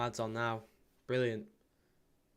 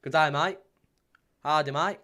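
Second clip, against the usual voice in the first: put-on Aussie greeting, louder; attempted Aussie accent; 3 semitones higher, 7 dB louder than usual. Streamer greeting an Aussie fan, mock Aussie accent. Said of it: "us Aussie Toon fan".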